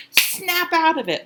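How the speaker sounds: high, loud, drawn-out mock yell; imitated movie slap line. Imitating [name] slapping someone in Moonstruck, exasperated yell. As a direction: quote it like a slap across the face. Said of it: "where [name] slaps".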